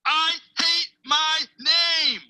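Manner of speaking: high, loud, drawn-out shout; yelled confession. Acted. Boy blurting the secret he promised to whisper, slow shout. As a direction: shout each word slowly, stretching it out.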